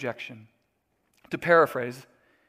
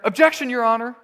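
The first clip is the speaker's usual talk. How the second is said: mock lawyer objection; 6 semitones higher, 6 dB louder, faster than usual. Mock courtroom objection again.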